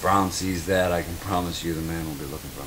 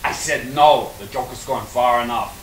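angry refusal; 5 semitones higher, 6 dB louder, slower than usual. Acted. Store manager refusing angrily.

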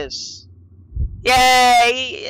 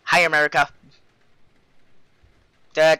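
normal talking voice

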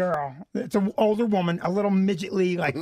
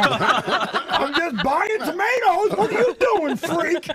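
high, laughing mock innocence then a yelp; high, laughing, hammed-up bystander voice; mock shopper; 8 semitones higher, 3 dB louder, much slower than usual. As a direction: play it breezy and absurd. Mocking shoppers' looks, acted.

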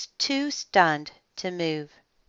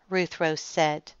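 plain reading voice

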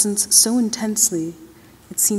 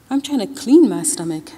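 louder, fed-up complaint; irritated sick man, raised voice; annoyed protest; 2 semitones higher, 7 dB louder than usual. A narrator's reading. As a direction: snap back at the suggestion. Hero protests, feeling sick.

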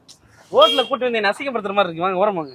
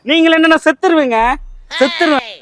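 higher, louder lovesick threat; melodramatic outburst; 6 semitones higher, 6 dB louder than usual. Acted. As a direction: burst out like a desperate suitor. Melodramatic threat to die for love.

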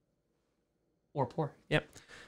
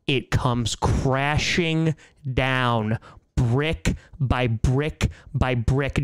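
slow and dramatic; 4 semitones lower, much slower than usual. Acted. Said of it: "slow dramatic".

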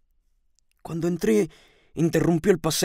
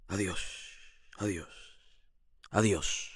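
low, very soft goodbyes, spaced apart; fading farewell; 10 semitones lower, 14 dB quieter than usual. A narrator's reading. Closing a lover's farewell letter, fading sadly. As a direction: let each goodbye sink away quietly.

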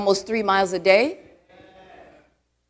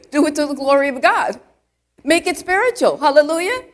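rising, louder preaching call; fervent exhortation; 6 semitones higher, 5 dB louder than usual. Real, not acted. Preacher urging the congregation, fervent and loud. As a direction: lift the church with it.

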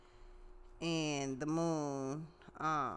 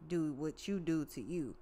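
ordinary talk

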